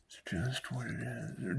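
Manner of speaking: soft whisper, slightly slow; whispered catchphrase. Real, not acted. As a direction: drop to a breathless whisper for the punchline. Catchphrase said under his breath.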